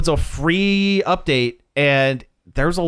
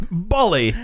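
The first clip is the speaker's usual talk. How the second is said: put-on British echo; mock British. Host echoing co-host's riff, mock British accent. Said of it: "mimicking, uh, British culture".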